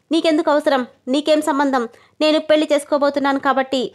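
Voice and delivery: ordinary delivery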